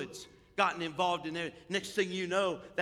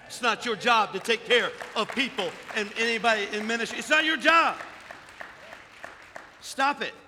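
high, loud rebuke from the pulpit; shouted scolding of the congregation; full-force preaching; 6 semitones higher, 8 dB louder than usual. Real, not acted.

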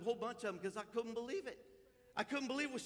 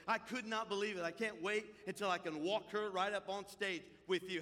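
normal talking voice

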